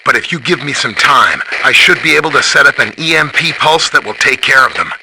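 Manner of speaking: filtered radio transmission; radio report. Acted. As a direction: call in a sighting over the comms. Reporting over a radio link.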